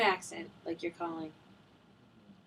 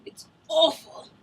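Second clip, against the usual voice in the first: Simon impression; 8 semitones higher, 8 dB louder than usual. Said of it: "Like Simon would say".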